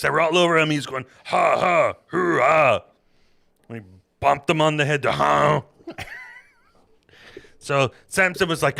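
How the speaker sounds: loud, high comic Bible retelling; boisterous mock-epic narration; hammed-up storytelling. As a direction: tell it like a rowdy campfire tale.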